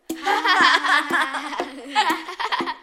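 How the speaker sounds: high nervous cackle; nervous laugh. Acted.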